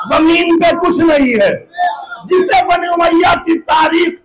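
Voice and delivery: ringing pulpit oratory with sustained pitch; impassioned preaching. Acted. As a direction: declaim forcefully to the assembly. Preacher declaims at full force.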